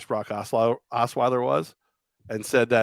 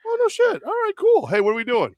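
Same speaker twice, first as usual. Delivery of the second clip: much higher, surprised and thrilled; hammed-up amazed teammates; delighted teammates; 4 dB louder than usual. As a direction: gasp it out, starstruck. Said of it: "They go".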